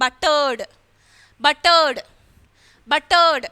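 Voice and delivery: Buttered and ബട്ടേഡ് as "butt-erd", voiced D at the end. Shown as a demonstration. Teacher drills the second rule, Buttered with a D ending. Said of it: "ഡി പോലെ pronounce ചെയ്യണം".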